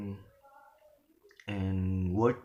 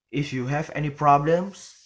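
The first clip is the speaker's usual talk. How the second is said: "problems" lifted loud and left open; rising intonation; 4 semitones higher, 7 dB louder than usual. Shown as a demonstration. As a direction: climb high to the end of "problems". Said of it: "the word problem is rising".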